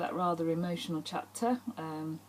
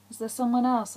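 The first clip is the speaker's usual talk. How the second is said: higher, louder pleading question; anxious question; 6 semitones higher, 7 dB louder than usual. A narrator's reading. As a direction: ask urgently as the rejected lover. Heroine questions departing lover.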